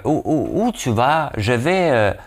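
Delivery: a hint of a Parisian accent; mock French accent. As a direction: tease the French way of asking where you go. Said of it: "les Français aiment ça à dire".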